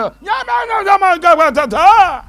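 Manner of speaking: loud, high wordless bleating; mute straining sounds. Retold or imitated. Struck-dumb priest trying to talk, loud high bleats. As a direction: try to speak but only strain out sounds.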